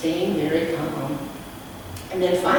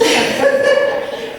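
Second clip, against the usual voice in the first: laugh; 6 dB louder than usual. Real, not acted. Narrator breaks into laughter.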